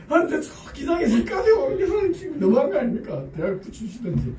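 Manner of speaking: high, whiny protest at God, then dropping away; mock complaining prayer. 수험생이 하나님께 높은 목소리로 투덜대며 따지는. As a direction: grumble to God like a sulking student. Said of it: "이렇게 기도한 거예요".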